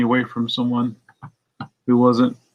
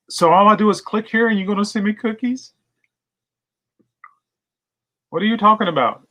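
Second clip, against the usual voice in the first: much higher, naive puzzled voice; playing dumb; 9 semitones higher than usual. Baiting the scammer, higher wide-eyed confusion. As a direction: play the clueless mark. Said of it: "I said".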